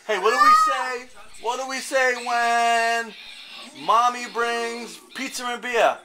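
drawn-out cheer leader prompt; hyped-up prompt. Real, not acted.

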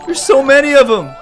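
high, loud cry of alarm; alarmed shout. Real, not acted. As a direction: yell it as the horde closes in. Swarmed by zombies.

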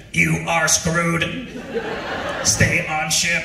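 slow, deadpan machine verdict; mock machine voice. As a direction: pronounce the doom slowly. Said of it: "the equipment was saying".

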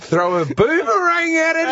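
high, slowed cartoon Australian accent; mock Aussie accent. Joining the bit, a high cartoon Aussie accent. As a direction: chime in as a silly Australian.

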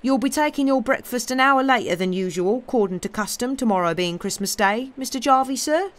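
lightly dialect servant voice, lifting high at the end; deferential servant, inquiring. Acted. Tom asks his master about Christmas breakfast, deferential. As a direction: ask respectfully, rising on the sir.

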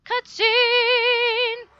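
very high, loud held note; high drawn-out announcement. Acted. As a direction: belt it out high and long. Mock announcing of a cutscene.

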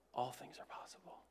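soft, whispered promise; hushed reassurance; tender whisper. Acted. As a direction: speak it gently as comfort. Quoting Jesus tenderly.

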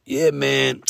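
put-on drawn-out drunk stranger's voice; drunk guy. Drunk fan agreeing as he leaves the game, drunk voice. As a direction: drag it out like a tipsy man. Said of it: "And he's all like".

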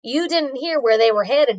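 ordinary delivery

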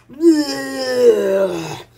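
long drawn-out retch; low gurgled fake vomiting; mock puking. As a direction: imitate a drawn-out puke. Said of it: "It's puking".

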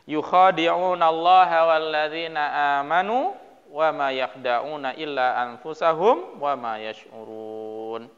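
measured, chanted Quran recitation. Acted. Recitation of verse again.

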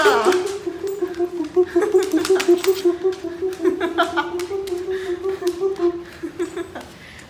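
delighted laugh. Real, not acted. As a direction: bursts out laughing. Laughing at dog.

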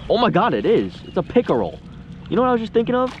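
loud, high excited exclamation; real amazed yelling; thrilled surprise. Real, not acted. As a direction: burst out as the surprise catch appears. Surprise catch of a pickerel.

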